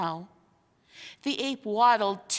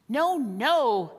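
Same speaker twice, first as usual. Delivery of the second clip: higher, louder shout of protest; crowd shouting; 4 semitones higher, 6 dB louder than usual. Acted. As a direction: cry out no as the crowd. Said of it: "shouted most of the crowd".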